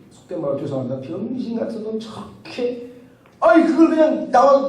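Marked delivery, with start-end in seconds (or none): 1.0-2.9 soft, slow scornful mutter under the breath; muttered insult. Retold or imitated. Southern pastor fuming to himself after the lecture, muttering softly. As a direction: grumble it to yourself.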